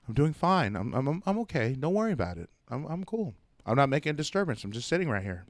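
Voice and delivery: quiet innocent reassurance to three cops; meek to the cops. Retold or imitated. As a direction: shrink down and play it cool for the police. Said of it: "I'm like".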